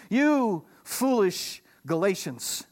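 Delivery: slow, stretched-out rebuke from the Apostle; Paul rebuking. Retold or imitated. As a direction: scold the church slowly, in disbelief. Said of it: "He says to them".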